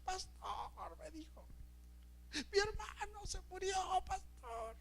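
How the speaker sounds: high, slow, anguished wailing voice; imitated grieving sister crying out to the pastor. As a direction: wail out her grief for her dead brother. Pegando de gritos.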